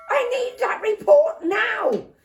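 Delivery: high loud desperate demand; frantic shout. Acted. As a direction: demand it right now. Dad frantic.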